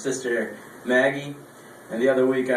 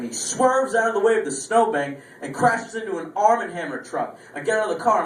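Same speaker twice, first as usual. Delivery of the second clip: ordinary talk, nothing marked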